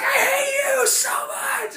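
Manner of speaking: very high, loud, slow wail, laughing through it; hammed-up crying rage; mock sobbing shout. Heartbroken neighbor wailing at girlfriend, mock sob-shout. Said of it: "He's like".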